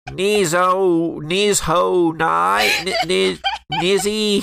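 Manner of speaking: nasal deputy fumbling a name. Acted. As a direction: stumble over the name, nasal. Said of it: "mispronouncing Owl's name".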